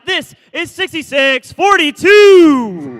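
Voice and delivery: slow, loud team callout; arena announcer. Acted. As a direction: call the number to the whole arena. Announcing team at robotics match.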